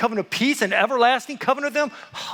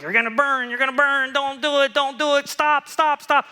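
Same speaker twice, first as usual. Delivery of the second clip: raised, insistent chant of warnings; mock prophetic warning; 4 semitones higher, 4 dB louder than usual. Preacher imitating the prophets warning of judgment, mock urgent. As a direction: hammer out the warnings in a mock panic.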